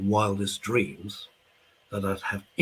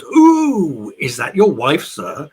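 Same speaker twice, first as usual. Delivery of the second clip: [name] impression; 3 semitones higher, 8 dB louder, slower than usual. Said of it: "[name] then looks at my fifty-seven-year-old father and goes".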